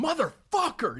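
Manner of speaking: high, loud comic curse; mock furious outburst. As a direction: curse it like a loser hurling his helmet. Gil throws his helmet.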